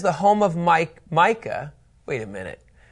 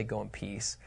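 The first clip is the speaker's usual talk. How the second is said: mock priestly line; 8 semitones lower, 18 dB quieter than usual. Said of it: "of course he's gonna say".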